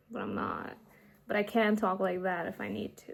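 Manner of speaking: ordinary delivery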